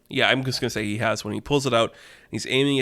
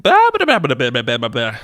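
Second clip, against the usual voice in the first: minion babble; 6 dB louder, faster than usual. Acted. Minion on the phone, random babble, squeaky. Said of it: "just random minion talk".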